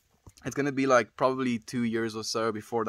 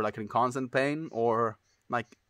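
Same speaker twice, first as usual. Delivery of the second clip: ordinary delivery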